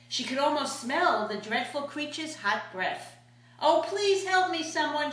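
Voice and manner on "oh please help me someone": frightened plea. Acted. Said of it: "It was pretty nasty being hunted".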